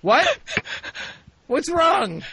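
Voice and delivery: ordinary talk, nothing marked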